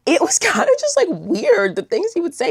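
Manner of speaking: high, laugh through the words; amused, pitch shooting up. Real, not acted. Teller recalling the weird things he would say, laughing.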